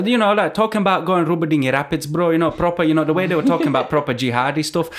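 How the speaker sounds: put-on Four Lions accent. Repeating it on request for co-hosts, Four Lions Sheffield accent. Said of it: "Say that again".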